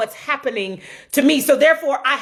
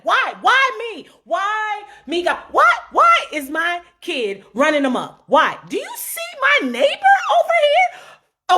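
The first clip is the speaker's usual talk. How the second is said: angry complaint to God; 9 semitones higher than usual. Acted. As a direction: rail at God like you've been wronged.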